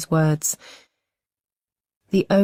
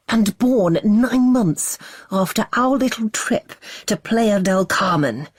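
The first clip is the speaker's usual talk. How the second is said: slow, higher, scornful and furious; scornful fury; 5 semitones higher, slower than usual. A narrator's reading. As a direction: spit it out with contempt. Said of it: "adding scorn to his fury".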